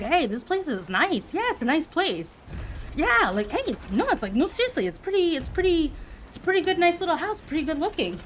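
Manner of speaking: lightly voiced impressed window worker, pitch rising; a hint of a worker marvelling at the house; surprised worker voice. Worker muttering to coworker, mildly surprised.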